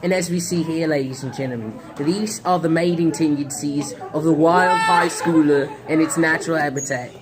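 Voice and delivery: mock wildlife narrator voice; nature documentary. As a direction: narrate teens like wild animals.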